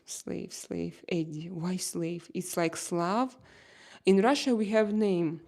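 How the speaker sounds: ordinary delivery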